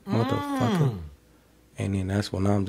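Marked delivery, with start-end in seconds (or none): 0.1-1.0 mock angry girlfriend. Girlfriend yelling, voiced.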